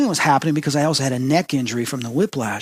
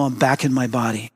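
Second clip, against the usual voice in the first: ordinary delivery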